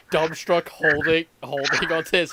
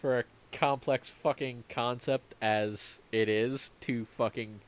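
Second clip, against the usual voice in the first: usual speaking voice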